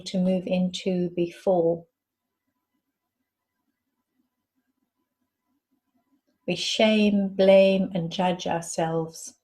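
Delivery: measured meditation guide, long silences between phrases; guided meditation. Acted. Guide asking listeners to breathe and rest into the space, calm meditation voice. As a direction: lead them inward in unhurried phrases, letting each one settle.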